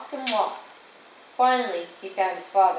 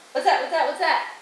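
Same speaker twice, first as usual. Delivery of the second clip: quick, repeated startled question; 4 semitones higher than usual. A narrator's reading. Jason waking to a strange sound, startled questioning. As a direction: pipe up as if jolted awake.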